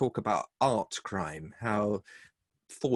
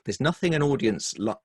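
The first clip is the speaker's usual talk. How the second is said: ordinary talk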